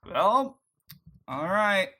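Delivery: high, loud, comically awkward dad; hammed-up voice with a pause between the words; Charlie. Dad reacting to the kiss confession, hammed-up awkwardness. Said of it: "And Charlie's just like".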